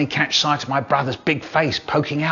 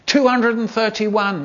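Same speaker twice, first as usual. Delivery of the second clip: May's guess; 9 semitones higher than usual. Acted. May guessing raisins count.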